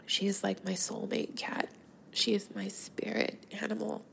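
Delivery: holding back tears, with long halting pauses; getting teary-eyed. Real, not acted. Podcaster mourning her soulmate cat, close to tears. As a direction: pause and sigh to keep composure. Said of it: "I'm gonna get teary-eyed here".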